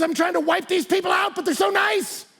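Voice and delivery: exasperated high-pitched mock shout; mad emperor. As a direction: wail in hammed-up frustration at your enemies. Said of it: "got so mad".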